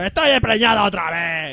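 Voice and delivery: slow, put-on hillbilly woman voice. Una gorda en la caravana. (voz de paleta, lenta y arrastrada)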